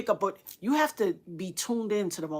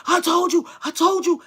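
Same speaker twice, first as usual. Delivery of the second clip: screaming; 8 semitones higher, 7 dB louder than usual. Retold or imitated. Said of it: "My son was screaming".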